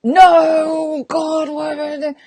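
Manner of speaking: high, drawn-out anguished "no"; inner scream. Acted. Coach inwardly regretting she agreed, mock-anguished wail. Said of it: "But inside I'm going".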